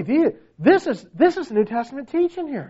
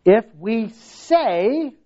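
"say" drawn out long; stress on SAY; much slower than usual. Shown as a demonstration. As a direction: stretch the word "say" slowly. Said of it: "notice this word".

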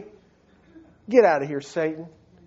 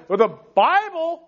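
mock helpless; 8 semitones higher than usual. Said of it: "you're gonna say".

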